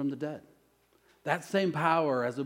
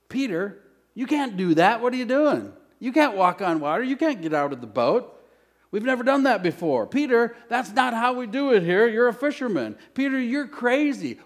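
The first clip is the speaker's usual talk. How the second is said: higher and louder, panicked pleading; disciples' alarmed voices; 8 semitones higher, 6 dB louder than usual. Retold or imitated. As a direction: voice the fearful disciples shouting at Peter. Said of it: "can you hear the other disciples?".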